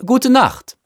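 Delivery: high, lifted good-night call; chorused farewell. A narrator's reading. Dinner guests answering the boy's good night, calling out. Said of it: "antworteten alle".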